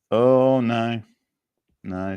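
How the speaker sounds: ordinary talk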